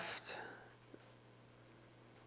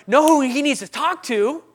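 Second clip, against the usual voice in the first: high, loud burst of a bright idea; retold eager voice, pitched well up; excited suggestion; 7 semitones higher, 5 dB louder than usual. Lady suggesting to dad who to talk to, excited. Lady's sudden idea, acted out.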